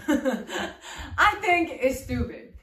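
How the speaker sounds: slow, laughing through the words; laughing repeat. Real, not acted. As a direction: repeat it slowly while laughing.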